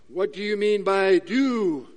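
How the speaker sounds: evasive politician. Acted. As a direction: dodge by drawing out the questioned word. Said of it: "politicians are good at this".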